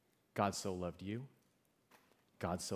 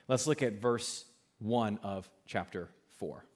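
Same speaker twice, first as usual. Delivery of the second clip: ordinary delivery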